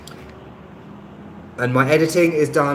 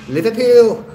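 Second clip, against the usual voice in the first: "Liverpool!" exclaimed in a broad Liverpool accent, much higher and louder; 9 semitones higher, 6 dB louder, slower than usual. Shown as a demonstration. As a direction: show off the one Liverpool word you can do, in a Liverpool accent. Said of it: "the only word I can say in a Liverpool accent".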